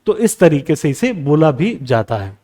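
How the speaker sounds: ordinary talk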